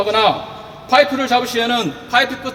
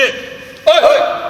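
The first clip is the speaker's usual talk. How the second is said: calling out; 4 semitones higher, 4 dB louder, much slower than usual. Real, not acted. Calling out before forklift check.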